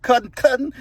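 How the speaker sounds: imitating "cousin, cousin" as said with two front teeth missing; missing-teeth speech. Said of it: "it always go like that".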